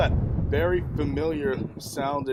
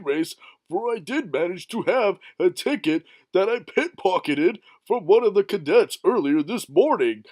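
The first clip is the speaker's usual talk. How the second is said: Goofy voice; 8 dB louder than usual. Acted. Goofy loud goofy narration.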